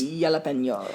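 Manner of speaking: low, soft "yalapenyo" for Jalapeno; varied pronunciation. Shown as a demonstration. Co-host interrupting softly, Jalapeno as "yalapenyo". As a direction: slip in Jalapeno with a "nyo" ending. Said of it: "trying out different ways of pronouncing".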